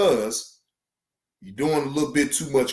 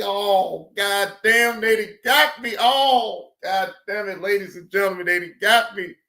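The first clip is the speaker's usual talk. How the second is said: raised, exclaiming lament; mock dismay; 6 semitones higher than usual. Streamer retelling seeing the ban, a raised lamenting outcry. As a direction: play it like you just got caught. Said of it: "I say".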